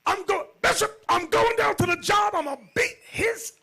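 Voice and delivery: very high, loud angry rant; mad brother. Retold or imitated. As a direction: rage down the phone. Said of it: "calls me mad".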